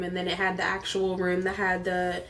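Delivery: normal talking voice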